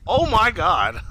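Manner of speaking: high-pitched retold shock; shocked exclamation. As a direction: exclaim it as if just witnessing something awful. Retelling his shock at the decapitation.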